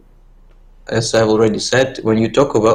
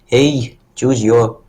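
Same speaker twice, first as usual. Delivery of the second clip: put-on Brazilian accent; 2 semitones higher, 4 dB louder, much slower than usual. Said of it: "My Brazilian accent wasn't the greatest".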